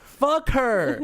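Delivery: high, sharp burst of outrage; indignant outburst. Real, not acted. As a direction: spit it out, offended. Outraged at the clerk's remark.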